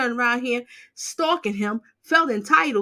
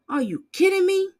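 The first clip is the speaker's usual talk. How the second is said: incredulous; 8 semitones higher than usual. Real, not acted. Outraged disbelief at caller.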